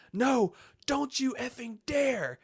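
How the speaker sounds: high, loud, drawn-out protest; anguished, forceful cry; desperate shout. Acted. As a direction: yell to stop him at all costs. Acting out Batman's desperate protest.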